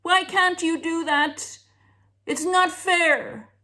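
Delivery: high, loud, whining tantrum; child screaming it's not fair in a tantrum. Retold or imitated. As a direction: wail like a hysterical kid. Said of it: "scream and shout and go hysterical".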